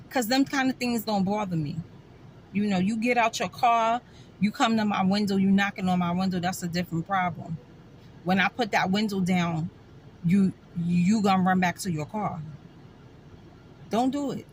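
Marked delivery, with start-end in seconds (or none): none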